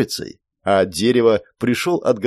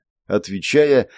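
ordinary delivery, nothing marked

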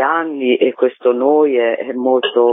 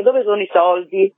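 higher, fast, mock-insistent asking; mocking the usual question; 9 semitones higher than usual. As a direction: imitate everyone pestering about the money. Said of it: "la prima domanda che ci fanno è". (domanda insistente imitata, più acuta)

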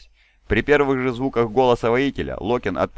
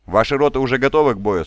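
commanding question; 5 semitones higher, 6 dB louder than usual. A narrator's reading. Commander addresses his companies.

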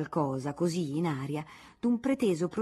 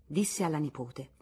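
plain reading voice

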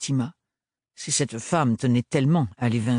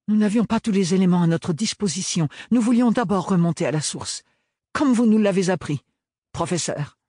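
formal, careful, earnest plea; earnest young apprentice; 8 semitones higher, 4 dB louder than usual. A narrator's reading. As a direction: defend yourself politely but firmly. Ophélie retorts. (jeune apprentie sérieuse)

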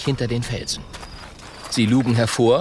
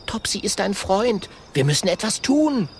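put-on higher, urgent young voice; young dragon voice; 9 semitones higher than usual. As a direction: urge the friend on. Little dragon voice.

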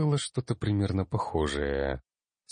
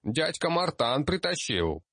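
put-on child gnome voice; 11 semitones higher than usual. Гномик продолжает хвастаться.

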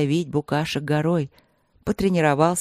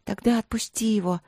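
put-on higher-pitched girlish request; pleading princess; 4 semitones higher than usual. A narrator's reading. Princess asking the prince to release the image, pleading. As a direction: ask it timidly. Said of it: "попросила принцесса".

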